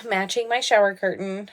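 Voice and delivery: ordinary talk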